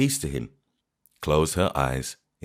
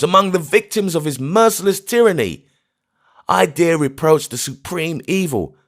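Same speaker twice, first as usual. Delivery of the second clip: defiant bitter scorn; 13 semitones higher, 7 dB louder than usual. Acted. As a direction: rail against heaven with bitter scorn. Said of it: "His accent of bitter scorn".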